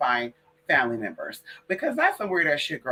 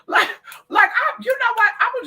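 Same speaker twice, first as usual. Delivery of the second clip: worked-up outburst; 5 dB louder than usual. Real, not acted. Sudden decision, louder.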